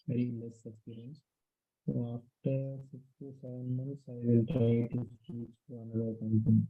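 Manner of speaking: very quiet, hard to hear; barely audible. Real, not acted. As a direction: drop the voice until it nearly fades. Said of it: "Your voice is very low".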